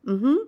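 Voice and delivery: mm-hmm with a lifted rising end; affirmative mm-hmm. Shown as a demonstration. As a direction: hum a clear rising yes, "Mm-hmm". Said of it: "answer in the affirmative like this".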